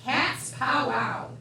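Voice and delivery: plain reading voice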